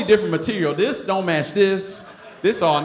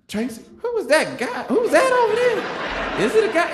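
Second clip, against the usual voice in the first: put-on much higher, giggly nosy girl voice; gossiping girls; 14 semitones higher than usual. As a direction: squeal it like curious teen girls. Neighborhood girls gossiping about him.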